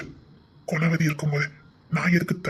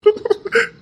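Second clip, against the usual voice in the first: laugh; 5 dB louder than usual. Acted. Husband laughs at her threat.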